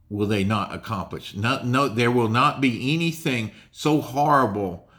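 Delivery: usual speaking voice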